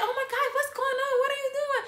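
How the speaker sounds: high mock alarm; panicked sister. The sister panics as the fight begins. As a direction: imitate a frightened sister.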